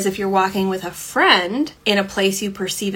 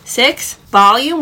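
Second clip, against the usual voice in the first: louder announcement; 4 semitones higher, 6 dB louder, slower than usual. Shown as a demonstration. Announcing tip six, volume.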